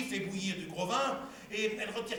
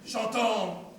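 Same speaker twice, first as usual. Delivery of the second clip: ordinary talk, nothing marked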